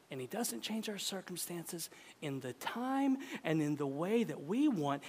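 usual speaking voice

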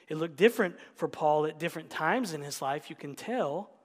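ordinary talk

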